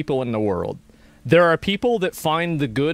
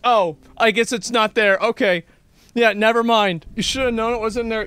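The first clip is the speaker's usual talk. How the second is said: dismissive mom; 7 semitones higher, 3 dB louder than usual. Retold or imitated. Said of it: "No, just".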